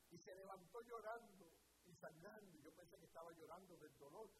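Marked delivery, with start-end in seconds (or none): none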